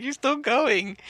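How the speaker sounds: mock disbelief. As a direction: squeak it out in disbelief. Incredulous at teammate.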